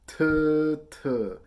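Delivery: loud "T" said as "tuh", twice; firm tongue-tip attack on each "T", voiced as "tuh"; T-tongue syllables. Shown as a demonstration. Teacher previewing quarter notes for players, loud "tuh" for each "T". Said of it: "on the T sound".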